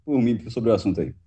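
half-choked and muffled; choking. Real, not acted. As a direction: speak while choking on food. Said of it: "Tá se engasgando agora".

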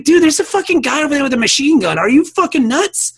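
you can't believe he's asking; incredulous outburst. Retold or imitated. To the kid selling weed near armed guards, a retold incredulous outburst.

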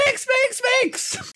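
very high squeaky calling; frantic little lizard. Acted. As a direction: squeak the name over and over. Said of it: "you're hearing in your voice".

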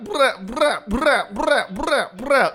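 sing-song bruh chant; high repeated bruh. Shown as a demonstration. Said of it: "doing that for syncing purposes".

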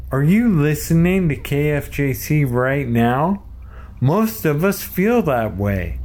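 upbeat promo announcer voice; radio ad read. Acted. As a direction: pitch it like a station promo. Reads radio fundraiser script.

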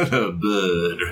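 "The bird" with the r dropped, close to "bid"; New Orleans bird. Shown as a demonstration. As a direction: say "The bird" Louisiana style, no r. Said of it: "it's like the word bird".

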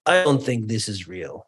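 imitated heavy Bulgarian accent; thick Bulgarian accent. Speaker quoting a man after his burnout talk, thick Bulgarian accent. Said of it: "in a very thick Bulgarian accent".

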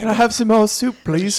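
high-pitched British orphan begging; mock British accent. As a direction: plead as a hammy British waif. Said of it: "that sounded more British".

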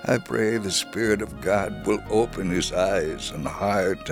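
ordinary delivery

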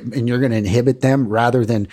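ordinary talk

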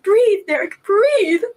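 loud, urgent calling of his name; desperate shout to a child; retold yell. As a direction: yell at him to breathe. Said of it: "I yelled".

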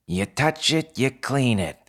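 high-pitched little fox snapping an order; barking fox voice. A narrator's reading. Fox co-manager warning the newcomer at the door, barking. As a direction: bark it out high, like a small bossy fox. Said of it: "barked a fox voice near her knees".

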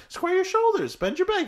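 high, eager cartoon helper voice, laughing through it; Mister Meeksy voice. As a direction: squeak it up like a chipper little helper who exists to serve. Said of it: "He's like".

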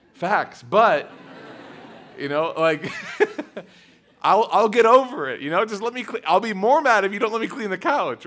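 laughing through a teasing answer; laughing reply. Retold or imitated. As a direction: chuckle while reassuring her. Father answering while laughing.

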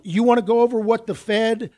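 usual speaking voice

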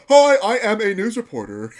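loud, high mock news reporter. Host imitating how Trey or Matt does it, mock reporter voice. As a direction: announce yourself as a hammed-up reporter. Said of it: "He's just like".

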